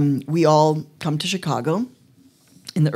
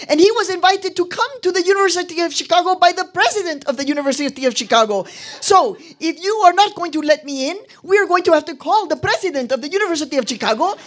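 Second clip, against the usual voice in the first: much higher, louder, commanding mom; firm mom voice; 6 dB louder, faster than usual. Retold or imitated. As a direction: stand up for the family, forceful. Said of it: "with her voice".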